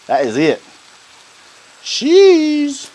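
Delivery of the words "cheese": squeaky high "Cheese"; high sing-song. Real, not acted. Sings out the word cheese.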